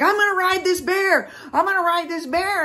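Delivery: high, loud imitation of a reckless buddy; mock excited boast. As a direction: whoop it out far above your normal pitch. Mimics reckless companion boasting.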